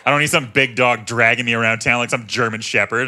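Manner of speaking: ordinary talk, nothing marked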